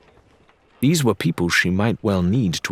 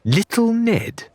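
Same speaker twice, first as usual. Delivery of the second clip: much higher, slow, disbelieving; surprised question; 14 semitones higher, slower than usual. A narrator's reading. Larina surprised at Ned's nickname, high and slow. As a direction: let the name hang in disbelief. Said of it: "she paused".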